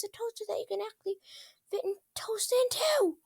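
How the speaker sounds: high, building rant that speeds up; ranting princess. Acted. Climbs high and races to a breathy finish. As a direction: Twilight telling Rainbow Dash about the toaster hunt, high and manic.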